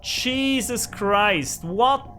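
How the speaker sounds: angry. Real, not acted. Gamer cursing the broken pickaxe, slow and angry. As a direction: drag out the curses in frustration. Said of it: "Now I'm angry".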